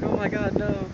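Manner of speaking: high-pitched retold panic; mock freak-out. Girl watching her virtual pet get eaten, mock freak-out. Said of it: "she freaks out".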